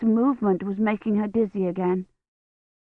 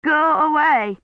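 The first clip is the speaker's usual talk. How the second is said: higher and louder, shivering go away; louder frightened plea; 8 semitones higher, 5 dB louder than usual. A narrator's reading. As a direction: push him off harder, with fear. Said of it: "her teeth chattering so hard".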